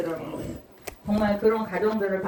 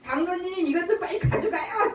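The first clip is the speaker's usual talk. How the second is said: higher calling voice with a laugh; mock call out; 10 semitones higher than usual. Pastor's wife calling the elder to carry heavy rice. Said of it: "이러면 제가 소리 질러요".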